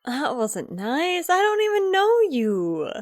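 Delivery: playfully hurt, pitch swooping up; mock offended. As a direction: gasp and protest with wounded innocence. Player reacts to middle finger in character.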